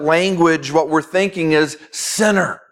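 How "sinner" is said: "sinner" set apart with weighty emphasis. Retold or imitated. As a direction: lean hard on the word "sinner". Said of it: "we say it with that emphasis".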